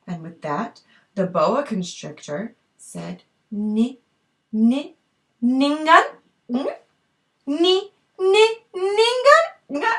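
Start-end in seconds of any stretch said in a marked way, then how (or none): 3.5-9.9 stuttering rising call of her name; teasing sing-song snake call. Acted. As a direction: tease her name, rising higher each time. Snake calls Ningun again.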